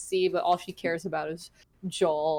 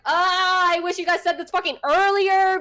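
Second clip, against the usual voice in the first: higher, louder exasperated rant; frustrated outburst; 11 semitones higher, 4 dB louder than usual. Retold or imitated. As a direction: vent your annoyance at the game loudly. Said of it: "very upset".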